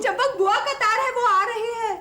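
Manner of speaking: high, worried wail; alarmed announcement. Acted. Wife warns husband that aunt is coming, high alarmed voice. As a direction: break the dreaded news in a high, anxious voice.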